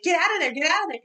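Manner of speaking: high-pitched mild mock yell; half-voiced imitation of a screamed warning; screaming warning. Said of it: "Megan is screaming to, to Tommy".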